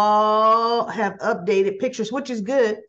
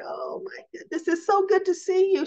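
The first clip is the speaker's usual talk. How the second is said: higher, gushing hello; excited greeting; 8 semitones higher than usual. Retold or imitated. Ashley hugging Mamie, thrilled and gushing. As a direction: gush over seeing her again.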